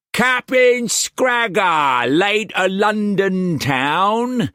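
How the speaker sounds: high, slow, loud cocky pirate; pirate calling back. A narrator's reading. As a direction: call it out grandly across the water. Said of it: "the man called back and guffawed".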